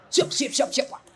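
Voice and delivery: lightly mimicked quick yes-sir replies; escort's salute. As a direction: play obedient escorts answering. Mimics escorts answering the regent.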